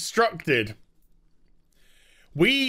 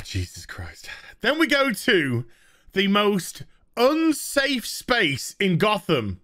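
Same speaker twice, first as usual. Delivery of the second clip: ordinary talk, nothing marked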